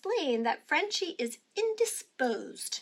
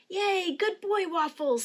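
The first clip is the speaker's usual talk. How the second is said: cheering; 7 semitones higher, 4 dB louder, slower than usual. A narrator's reading. Girl cheers her dog.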